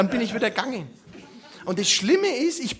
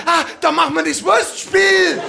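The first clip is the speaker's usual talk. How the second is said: louder, higher gleeful butcher; delighted agreement; 4 semitones higher, 5 dB louder than usual. Retold or imitated. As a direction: cheerfully join the plot. (fröhlich aufgekratzt)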